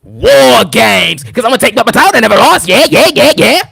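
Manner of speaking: loud, hammed-up promo shout; rowdy mock wrestling announcement; wrestler impression. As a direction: belt it out, then rattle on fast. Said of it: "she then says".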